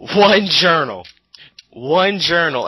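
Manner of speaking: ONE stretched out slow and loud; slow loud mock echo. Mocking the one journal, loud slow ONE. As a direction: drag out ONE in loud mockery.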